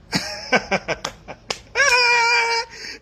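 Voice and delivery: long high burst of laughter; big laugh. Real, not acted. Speaker laughing hard at supporters' reaction.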